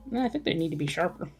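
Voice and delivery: normal talking voice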